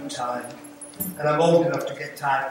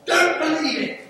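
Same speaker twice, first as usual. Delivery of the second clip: excited exclamation; 11 semitones higher than usual. Real, not acted. Defying his own death notice.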